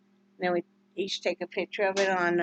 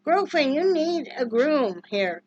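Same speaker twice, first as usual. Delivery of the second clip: pet talk; 5 semitones higher, 5 dB louder, slower than usual. Real, not acted. Talking to the dog.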